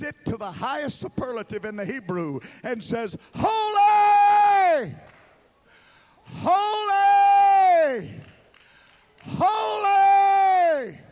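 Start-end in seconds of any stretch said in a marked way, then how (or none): 3.4-11.0 high, loud, drawn-out holy; exalted cry. Acted. Seraphim crying out before the throne, high and loud. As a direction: cry each holy out like an angel.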